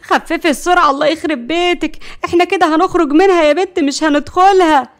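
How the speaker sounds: high, loud panicked scolding; terrified. A narrator's reading. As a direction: yell at the driver in alarm. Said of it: "نرجس كانت مرعوبة".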